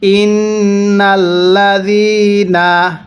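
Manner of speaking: slow, drawn-out tajweed chanting; slow Quran recitation. Shown as a demonstration. Teacher demonstrating recitation to students, slow chant. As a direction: recite each syllable long and measured. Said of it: "হ্যাঁ, পড়ুন".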